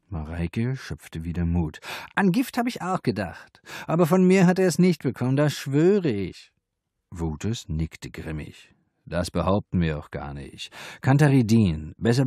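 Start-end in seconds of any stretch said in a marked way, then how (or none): 2.2-6.5 high, rushed madam voice insisting she's innocent; madam swearing to the police; pleading madam. A narrator's reading. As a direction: swear it quickly, high and eager.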